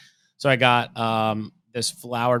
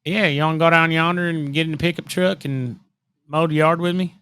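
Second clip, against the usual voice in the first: higher, country Oklahoma twang; Oklahoma accent; 4 semitones higher, 3 dB louder than usual. Acted. Inviting someone to mow the yard, Oklahoma accent. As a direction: put on a rural Oklahoma accent. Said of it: "That's an Oklahoma accent".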